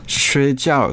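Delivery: "Shui jiao" repeated clearly after the recording; Mandarin demo. Shown as a demonstration. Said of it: "le verbe qui veut vraiment dire dormir". ("Shui jiao" prononcé en mandarin, bien articulé)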